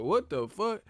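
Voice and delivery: ordinary talk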